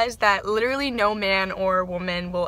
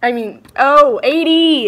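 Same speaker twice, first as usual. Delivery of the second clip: loud, higher oh of realization; sudden realization; 4 semitones higher, 13 dB louder, slower than usual. Real, not acted. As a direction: blurt it out as it clicks. Realizes math mistake.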